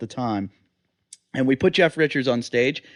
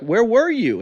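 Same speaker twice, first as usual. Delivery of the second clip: high-pitched baffled question; retold incredulous demand; 8 semitones higher, slower than usual. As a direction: press him for an explanation, pitch raised. Confronts Dave about his absence.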